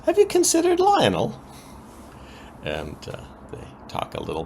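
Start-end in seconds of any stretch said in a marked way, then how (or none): 0.1-1.4 much higher, teasing mock voice; hammed-up coy question; sly suggestion. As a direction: slip the name in with a knowing lilt. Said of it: "And then she says".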